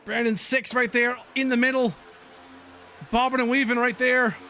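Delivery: brisk race call building toward the finish; racing commentator calling the last laps; live play-by-play. Acted. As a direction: call the pack as it jostles for position.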